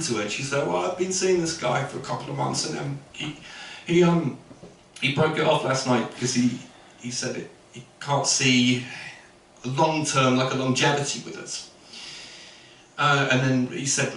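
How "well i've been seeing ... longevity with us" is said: subdued, hesitant heartbreak; halting, softly acted hurt with pauses; sad girl. Sad girl telling friends about her breakup, quietly hurt. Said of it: "original sad girl starts talking".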